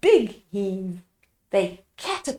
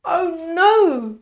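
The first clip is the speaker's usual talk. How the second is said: higher, louder wail of dismay; dismayed cry; 7 semitones higher, 4 dB louder than usual. A narrator's reading. Two villagers crying out, dismayed. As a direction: let it drop in disappointment. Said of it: "cried the two villagers".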